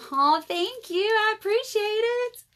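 high, gushing thank-you; touched thanks. Real, not acted. Thanking viewer for birthday wishes.

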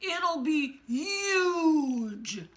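slow, drawn-out bragging promise; boastful politician. Acted. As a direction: stretch every boast out grandly. Donald boasting, impression-like.

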